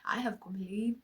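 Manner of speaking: whispered admission; quiet whispered confession. Real, not acted. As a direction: breathe it out softly. Whispered list of complaints.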